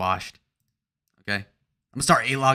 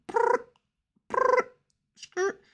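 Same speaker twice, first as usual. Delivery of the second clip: high rapper ad-libs; much slower than usual. Pretend. Ad-lib squeals.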